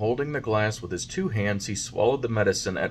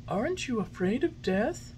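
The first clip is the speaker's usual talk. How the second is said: high, gentle fairy voice; fairy, patient; 13 semitones higher, 3 dB quieter than usual. A narrator's reading. Fairy patiently coaxing the puppet. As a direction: soothe him kindly.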